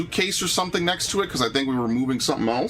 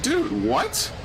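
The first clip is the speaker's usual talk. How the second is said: incredulous. Retold or imitated. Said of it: "I'm like".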